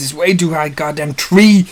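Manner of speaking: usual speaking voice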